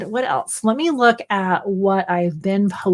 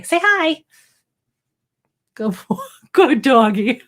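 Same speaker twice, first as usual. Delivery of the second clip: dog-talk voice; 4 semitones higher, slower than usual. Real, not acted. Talking to the dog.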